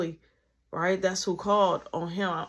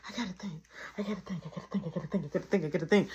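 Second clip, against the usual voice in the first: panicked muttering; 6 dB quieter, much faster than usual. Retold or imitated. Alone in the wet kitchen, panicking quietly to herself. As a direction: talk yourself through panic under your breath.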